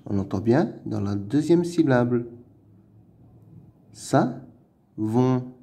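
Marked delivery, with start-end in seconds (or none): 3.9-5.5 "Savon" as "sa", pause, "von"; syllable by syllable. Shown as a demonstration. Teacher splitting a word for pupils, "Savon" in two syllables. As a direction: separate both syllables of "Savon" clearly.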